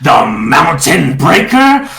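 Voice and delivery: slow, booming giant threat; avalanche-like roar. Acted. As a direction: roar it out slowly like a mountain. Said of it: "a deafening avalanche-like roar".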